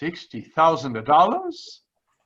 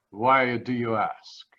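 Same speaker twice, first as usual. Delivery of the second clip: normal talking voice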